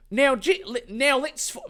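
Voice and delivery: Jack's line in a high Australian twang; Australian accent. Acted. Jack giving orders to the group, in an Australian accent. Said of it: "it's Australian again".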